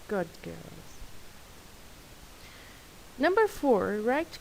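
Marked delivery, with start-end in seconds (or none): none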